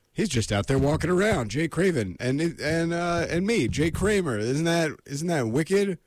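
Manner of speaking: mildly excited, showing off; excited retelling. Retold or imitated. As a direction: replay your own keen boast to the author.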